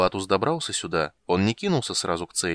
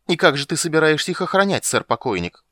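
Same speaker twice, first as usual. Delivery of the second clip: higher, louder mocking taunt; 8 semitones higher, 7 dB louder than usual. A narrator's reading. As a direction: taunt him with scorn. Warrior mocks the ghost knight.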